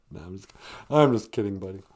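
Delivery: ordinary talk, nothing marked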